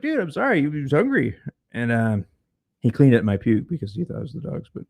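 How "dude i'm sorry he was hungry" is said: sheepish apology. Retold or imitated. Drunk tenant apologises to furious roommate, sheepish. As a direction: plead lamely like a guilty kid. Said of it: "I was like".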